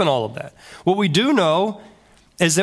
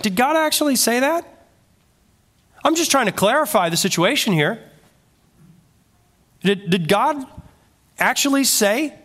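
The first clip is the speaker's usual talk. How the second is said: higher, lightly voiced friendly question; friendly innocent question; 7 semitones higher than usual. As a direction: ask it casually, as if just clarifying. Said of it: "It was probably quite friendly".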